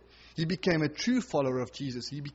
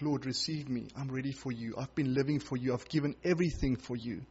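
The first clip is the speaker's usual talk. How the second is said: tender prayer; 3 semitones lower, 7 dB quieter than usual. Acted. When he cries out.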